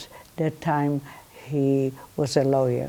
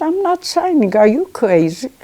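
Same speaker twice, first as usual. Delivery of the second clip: higher, louder indignant refusal; husband's outraged refusal; 8 semitones higher, 6 dB louder than usual. Retold or imitated. Husband refusing to sign away his wife, outraged. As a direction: voice the husband balking in disbelief.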